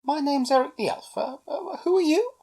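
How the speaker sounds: high-pitched, lightly voiced elf; high, uncertain greeting; hesitant elf introduction. A narrator's reading. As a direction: introduce yourself, then falter before asking. Elf hesitantly introduces himself to stranger.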